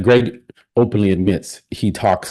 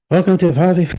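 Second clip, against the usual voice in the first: brief, much higher mock Pakistani accent greeting; 11 semitones higher, 4 dB louder than usual. Imitating the Pakistani YouTuber's channel greeting in a put-on Pakistani accent. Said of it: "his accent for being from Pakistan".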